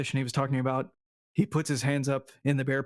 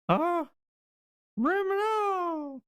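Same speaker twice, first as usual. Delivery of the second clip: very high mimicked squeak at a door; mouse squeaking; much slower than usual. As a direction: squeak nonsense syllables like a mouse. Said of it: "kinda mimic what he thought he heard".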